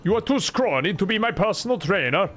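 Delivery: high, sing-song fake Swedish accent; mock Swedish accent. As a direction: play an earnest Swede with a hammed-up accent.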